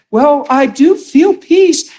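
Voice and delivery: slow, high-pitched quoting of the mother; high, drawn-out mock reply; retold mother's reply. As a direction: draw it out high, imitating her answer. Retold mother answering on phone.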